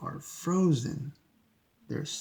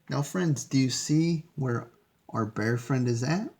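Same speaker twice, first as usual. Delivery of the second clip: usual speaking voice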